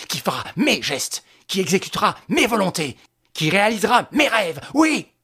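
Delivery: loud, raging megalomaniac tirade; violent rant. A narrator's reading. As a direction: Lupin pacing, shouting his dream of power. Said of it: "éclatait la violence de ses pensées secrètes". (tirade rageuse et exaltée)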